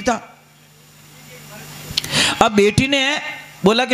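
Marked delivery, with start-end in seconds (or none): none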